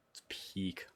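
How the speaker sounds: whispered in awe; soft awed whisper. Real, not acted. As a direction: breathe it out reverently. Whispers admiration.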